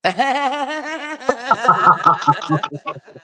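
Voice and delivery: long, loud, high laugh; real high-pitched belly laugh; hearty laugh. Real, not acted. Laughing at being asked if Australian.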